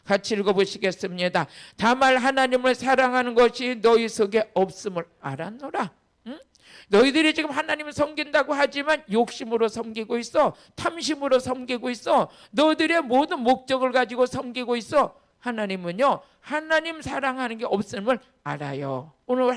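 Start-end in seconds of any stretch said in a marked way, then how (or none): 1.8-5.9 scripture read aloud. Acted. Congregation reads verse aloud. 6.9-15.2 preaching rebuke. Acted.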